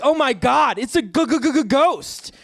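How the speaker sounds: high, stuttering scared voice, slow; hammed-up fright stammer; mock terror. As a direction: homophobe shaking with fake fear at a ghost. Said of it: "They're like".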